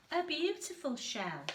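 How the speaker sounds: ordinary delivery, nothing marked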